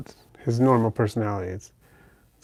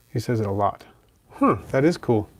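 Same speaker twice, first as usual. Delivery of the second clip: ordinary talk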